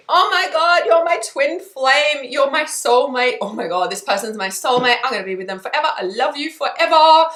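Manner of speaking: high, loud gushing infatuation; love bombing. Retold or imitated. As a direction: gush over a new soulmate breathlessly. Gushing over a new soulmate.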